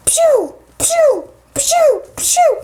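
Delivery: high-pitched "pew" bursts; martial arts strike noises. Acted. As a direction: play-act rapid kick sound effects.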